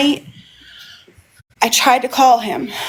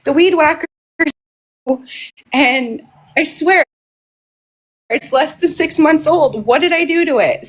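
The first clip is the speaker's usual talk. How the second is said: mock tearful complaint, pitched higher; retold crying; 5 semitones higher than usual. Upset daughter on the phone to mom about the weed whacker, mock crying. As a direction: imitate your own upset wail, lightly. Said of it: "I'm sitting there crying to my mom".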